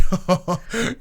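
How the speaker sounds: short amused laugh. Real, not acted. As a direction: burst out laughing. Retelling own reaction to match.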